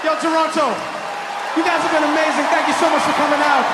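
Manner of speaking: high, loud thanks to a crowd; shouted sign-off. Real, not acted. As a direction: shout your thanks to a whole arena.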